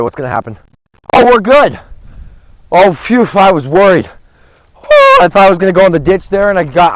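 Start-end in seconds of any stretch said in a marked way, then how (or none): 1.1-6.9 high, loud burst of relief after the drive; relieved excitement. Real, not acted. Disc golfer sees his drive clear the ditch, loud relieved joy. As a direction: blurt it out, thrilled it stayed dry.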